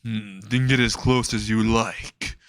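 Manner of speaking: slow, drawn-out villain taunt; slime villain. Retold or imitated. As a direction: draw it out like a menacing slime. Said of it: "Then the slime says".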